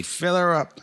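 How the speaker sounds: mock motorist ordering an attendant; customer's order. Acts customer ordering the orphan.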